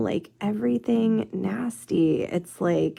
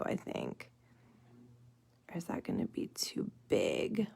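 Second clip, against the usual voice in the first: whisper; 10 semitones lower, 10 dB quieter than usual. Real, not acted. Whispering doubts over the nail.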